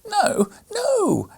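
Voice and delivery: put-on a child crying out in refusal; weeping girl. A narrator's reading. Maria refusing Fred, very high and tearful. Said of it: "exclaimed Maria, weeping".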